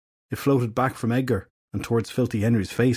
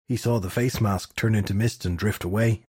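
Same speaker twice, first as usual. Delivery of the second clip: ordinary delivery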